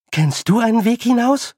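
high, loud, winded question; puffing while running. Acted. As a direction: ask urgently, puffing from the run. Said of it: "schnaufte Tiffany". (schnaufend vom Laufen)

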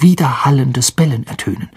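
ordinary delivery, nothing marked

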